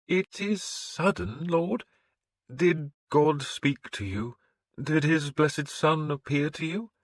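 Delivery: the priest questioning a pagan lord; wary priest. A narrator's reading. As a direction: probe him gently as the suspicious priest.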